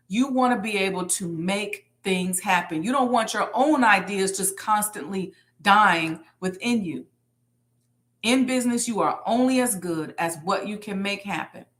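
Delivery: ordinary talk, nothing marked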